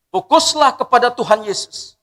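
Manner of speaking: "Fokuslah" lifted high and loud with a rising tune; loud preached exhortation. Shown as a demonstration. Preacher urging the congregation to focus on Jesus, loud. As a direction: proclaim it to the whole congregation. Preacher repeats key phrase.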